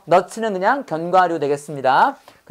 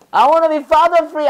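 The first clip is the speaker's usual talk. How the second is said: put-on high, slow childish plea; kid voice; 8 semitones higher, 4 dB louder, much slower than usual. Teacher playing a child who wants a day free of father, pleading. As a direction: play a kid wishing dad away. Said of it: "아버지 없는 하루".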